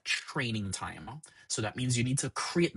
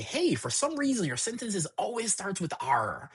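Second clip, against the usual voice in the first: much higher, chirpy voice for the model; voicing the model; 10 semitones higher than usual. Explaining training, voices the model noticing a pattern in a much higher voice. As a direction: pitch way up as the puzzled AI.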